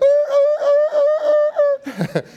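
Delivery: treeing hound bay. Retold or imitated. Preacher acting a hound treeing on a hot trail, wild high baying. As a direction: yelp like a hound gone nuts at the tree. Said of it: "I was like".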